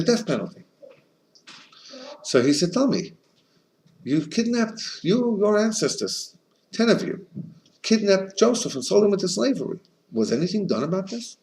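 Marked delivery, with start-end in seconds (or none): 2.7-11.4 lightly voiced sage, pitched higher, pressing the emperor; a hint of a sage cornering the emperor with questions; sage challenging emperor.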